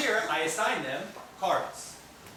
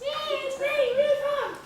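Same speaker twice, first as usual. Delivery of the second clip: squeaky high mouse reading, louder; 6 dB louder than usual. Shown as a demonstration. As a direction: read like a tiny mouse, very high. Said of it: "reading like a mouse".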